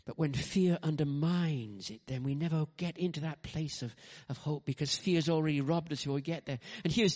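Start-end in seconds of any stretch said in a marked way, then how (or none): none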